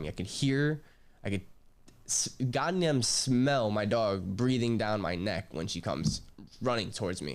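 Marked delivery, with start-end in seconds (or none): none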